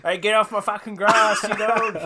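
loud, high, angry Aussie yell; aussie tradesman. Retold or imitated. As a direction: bellow like an angry old Aussie. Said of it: "Remember that guy?".

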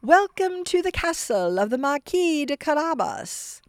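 high, bright welcoming cry; gleeful. Acted. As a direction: announce it with delight. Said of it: "exclaiming with glee".

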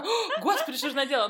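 high-pitched mock panic; mock dismay. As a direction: gasp it out in fake horror. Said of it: "И потом такая".

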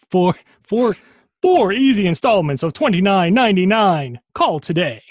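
high, loud, pushy pitchman; infomercial announcer. Pretend. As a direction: hype the payment plan. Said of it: "random commercial segment".

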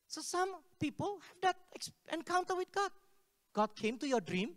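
ordinary talk, nothing marked

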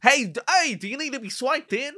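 loud, high New York holler; hammed-up New York street voice; mock New York accent. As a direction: shout it like a pushy New Yorker. Replays the quote as a New Yorker.

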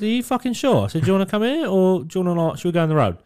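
high mock confrontation; hammed-up threatening doorman; high mock tough-guy threat; mock tough challenge. Retold confrontation.

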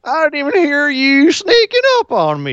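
very high-pitched cartoon voice; voice-cracking cartoon character. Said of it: "he cracks his voice constantly".

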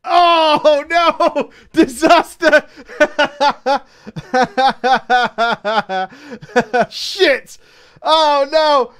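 loud, high laughing dismay; real laughing panic; laughing horror. Real, not acted. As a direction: shriek with laughing despair.

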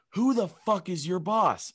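high, slow, insistent demand; insistent confrontation. Retold or imitated. Woman repeating her question to a baffled stranger, insistent. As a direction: press the question hard, word by word.

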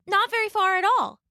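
put-on high-pitched little girl; Little Red. A narrator's reading. Little Red politely thanking the wolf, high girlish voice.

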